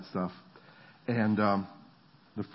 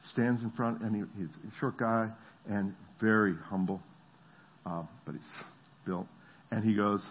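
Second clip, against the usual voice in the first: usual speaking voice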